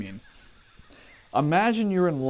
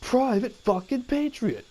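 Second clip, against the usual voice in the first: high, slow roll-call bark; exasperated drill sergeant; 9 semitones higher, slower than usual. Retold or imitated. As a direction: call the name, losing patience. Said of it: "he goes".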